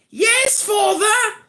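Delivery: very high put-on boy's voice; son impersonating father. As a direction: answer as a squeaky little son. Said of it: "You wanna hear one of my sons impersonate me?".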